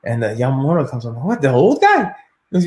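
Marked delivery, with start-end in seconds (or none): 1.2-2.0 high, louder disbelieving question; taken aback, pitched up; incredulous. Retold or imitated. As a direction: retell it as stunned disbelief. Hears old opponent called, taken aback.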